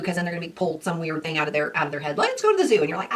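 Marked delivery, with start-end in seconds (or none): 2.2-2.8 put-on high eager child voice; eager kid. Child asked what to do, high eager kid voice. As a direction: chirp it like a keen little kid. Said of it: "child answering open question".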